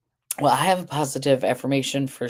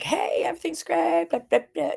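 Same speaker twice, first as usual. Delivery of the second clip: mocking a much higher, over-bright cheery voice; crazy perky; 13 semitones higher than usual. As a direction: send up the relentlessly upbeat type. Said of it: "crazy perky".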